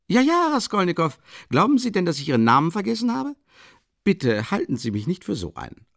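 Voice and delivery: höher und lebhafter, jovial; aufgekratzter Leutnant. A narrator's reading. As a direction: redselig und überschwänglich plaudern. Leutnant in gehobener Stimmung redend. (der Leutnant redet gut gelaunt auf Raskolnikow ein)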